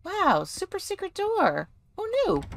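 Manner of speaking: high, squeaky wonder; mock amazement. Finds secret door.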